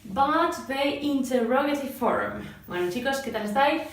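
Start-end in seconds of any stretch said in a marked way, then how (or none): 0.1-2.5 slow, careful classroom English; measured teaching voice for Spanish learners; slow teacher English. Acted. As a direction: spell it out slowly for beginners.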